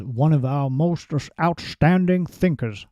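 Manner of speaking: a light, badly done Obama voice, barely shifted; a half-hearted try at Obama's measured delivery; terrible Obama impression. Vlogger quotes Obama praising Brzezinski, in a badly done Obama voice. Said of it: "That was a terrible Obama accent".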